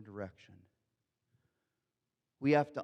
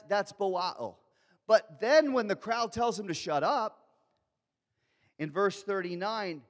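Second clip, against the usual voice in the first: ordinary talk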